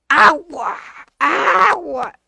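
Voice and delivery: put-on high, drawn-out child's yell; niño gritando. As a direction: wail the word like a demanding boy. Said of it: "Y gritaba". (grito agudo de niño exigiendo)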